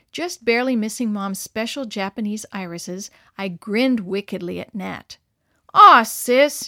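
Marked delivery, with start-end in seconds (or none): 5.8-6.6 loud, higher wail of complaint. A narrator's reading. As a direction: wail it out at your sister. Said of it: "he wailed".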